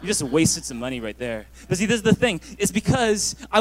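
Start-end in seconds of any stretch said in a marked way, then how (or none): 0.0-1.5 low, mocking guy voice; guys' voice. Pastor mimicking guys scoffing at him leaving, low and mocking. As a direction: put on a low dismissive dude voice. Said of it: "Some of the guys are like".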